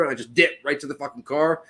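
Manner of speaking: usual speaking voice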